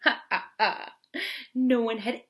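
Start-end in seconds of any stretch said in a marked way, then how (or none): 0.0-0.9 louder spoken mock laugh; lightly acted hearty laugh. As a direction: read out a big hearty laugh. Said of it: "The mouse laughed heartily".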